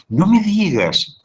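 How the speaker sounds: high, slow, glad no me digas; pleased astonishment at good news; happy surprise. Shown as a demonstration. Friend reacts to Margarita's new car with delight. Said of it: "en vez de decir".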